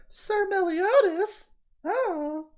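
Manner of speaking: put-on very high, drawn-out girly cry; slow, high-pitched mock whimper of a flustered anime girl; anime girl squeal. Fan imitating the anime heroine's cry for a friend, mock squeal. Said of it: "That's literally what she sounds like".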